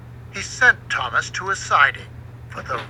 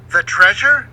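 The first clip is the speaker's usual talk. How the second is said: eager question; 5 semitones higher, 7 dB louder than usual. A narrator's reading. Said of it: "Thomas asked".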